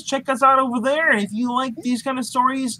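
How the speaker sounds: ordinary talk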